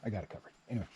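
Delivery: ordinary talk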